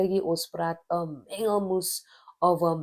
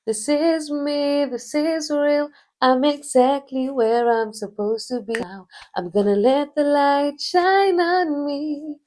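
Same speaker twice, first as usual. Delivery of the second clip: proud declaration; 10 semitones higher, 8 dB louder than usual. Real, not acted. Speaker in her vlog declaring herself with loud pride. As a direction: belt it out like an anthem.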